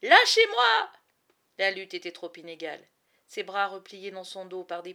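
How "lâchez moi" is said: high, loud, frantic yell to be let go; desperate cry. Acted. Boy held by soldiers screams to be released, frantic. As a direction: scream it while struggling against grips.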